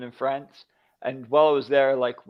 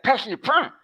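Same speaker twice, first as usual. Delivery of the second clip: higher, exaggerated Quebecois French imitation; Quebecois French accent; 9 semitones higher, 3 dB louder than usual. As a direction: parody the Quebecois twang on the French. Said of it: "And you're like".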